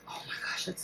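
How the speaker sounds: breathless, hushed oh my gosh; awed whisper. Real, not acted. Vlogger sniffing a wax melt she loves, whispering in awe. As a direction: hush it out while savouring the scent.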